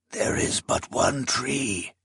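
slow, soft, uncanny voice; as if someone else speaks through him; voice not his own. Acted. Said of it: "his voice not his own".

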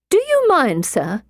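a prim lady bristling at him; indignant lady. Acted. Lady character voiced higher.

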